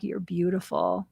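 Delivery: softly, tenderly reassuring. Retold or imitated. Stylist softly tells the woman she is beautiful. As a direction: say it gently like a caress. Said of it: "I'm like".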